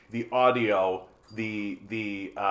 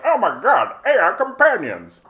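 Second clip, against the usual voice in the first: higher, louder fake gasp of amazement; mock shock; 9 semitones higher, 8 dB louder than usual. Streamer to chat, mocking people amazed by AI with hammed-up shock. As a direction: ham up being stunned. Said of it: "I'm not sitting here being like".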